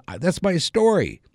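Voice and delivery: high-pitched mock insistence, falling at the end; insistent protest. Comedian finishing his story for the trooper, insistent. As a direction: imitate a man resting his case, pitched high.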